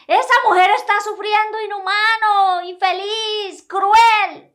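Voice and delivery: scolding crowd voice; indignant crowd insults. Retold or imitated. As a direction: hurl the insults in disgust. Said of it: "De todo le decían".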